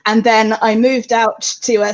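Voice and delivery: usual speaking voice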